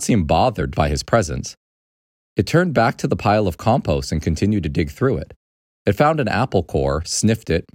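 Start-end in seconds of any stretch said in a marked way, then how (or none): none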